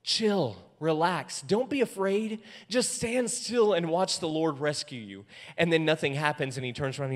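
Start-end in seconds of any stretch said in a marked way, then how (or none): 0.0-5.2 mock laid-back reassurance, pitched up; easygoing, drawn-out calming-down act; acting all cool. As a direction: play it cool and soothe the crowd. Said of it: "acting all cool and everything".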